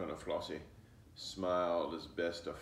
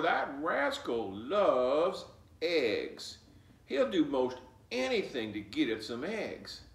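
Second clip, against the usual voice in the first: higher, louder grandma, slowing on rascal loves eggs; grandmother voice; 8 semitones higher, 6 dB louder than usual. Acted. Grandmother warning Flossie the fox loves eggs. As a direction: explain like a knowing old grandma.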